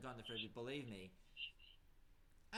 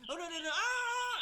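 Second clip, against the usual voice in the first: high, loud cry of alarm; panicked scream; 8 dB louder than usual. Acted. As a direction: scream as you get attacked. Toy Ghostface being scratched.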